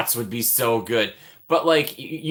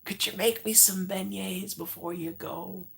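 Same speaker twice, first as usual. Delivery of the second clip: high, soft, slow wheedle; pleading roommate; 10 semitones higher, 9 dB quieter than usual. Acted. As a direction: ask in a small, high pleading voice. Imitates pleading roommate to Tiana.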